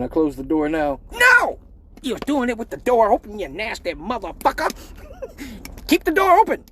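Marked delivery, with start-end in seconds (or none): 1.1-4.7 very high mock yell of a scandalized mom; high hammed-up mom yelling. 5.9-6.6 very high mock yell of a scandalized mom; high hammed-up mom yelling.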